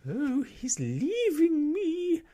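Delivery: very high, loud, slow shriek of despair. Acted. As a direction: shriek as your dog leaves you. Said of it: "shrieked Miss Lark".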